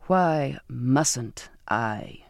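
very slow, low challenge; captain's slow, low retort; menacing question. A narrator's reading. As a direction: draw each word out, low and deliberate. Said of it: "he asked".

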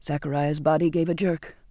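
ordinary delivery

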